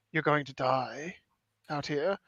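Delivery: slow, soft and grave; grave, serious. Retold or imitated. Survivor telling a mortally wounded man, grave and serious. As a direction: break the hard truth quietly, without false comfort. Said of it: "the serious nature of it".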